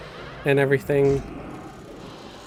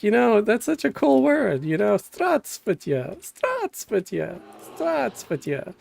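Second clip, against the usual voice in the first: higher, slower, savoring Здравствуйте over and over; goofy enthusiasm; 7 semitones higher than usual. Retold or imitated. Telling his Russian girlfriend how cool the word is, with goofy delight. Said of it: "Do you know how stupid you sound right now?".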